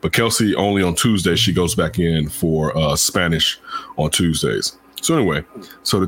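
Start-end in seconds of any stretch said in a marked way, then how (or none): none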